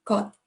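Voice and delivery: 「Got」 short and straight, no gut vowel. Shown as a demonstration. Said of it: "The next word is got".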